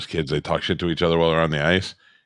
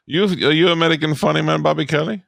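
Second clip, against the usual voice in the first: mock foreign official; 9 semitones higher than usual. Mocking Coast Guard boarding crew.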